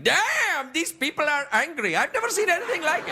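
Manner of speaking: high, stretched exclamation of astonishment; mock amazement. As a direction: act out a visitor stunned by what he sees. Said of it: "like that".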